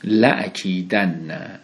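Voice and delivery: لأکیدن read slowly, doubled n held; careful Arabic recitation. Shown as a demonstration. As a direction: stretch لأکیدن syllable by syllable. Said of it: "این لام تأکیده در واقع".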